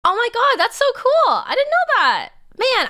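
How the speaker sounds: high and loud with delight; a streamer amazed by a chat fact, squealing; excited. Real, not acted. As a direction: squeal it, thrilled by the news.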